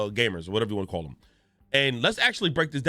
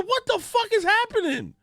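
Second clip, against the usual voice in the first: much higher, frantic questions; spiralling into comic panic; panicked disbelief. Retold or imitated. Husband reacting to his crying wife in higher comic panic. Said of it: "I said".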